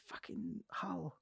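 muttered disgust. Retold or imitated. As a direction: mutter it slowly under the breath. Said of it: "And I was like".

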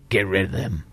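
lightly voiced gruff thug; low gruff order. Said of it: "A gruff voice rings out".

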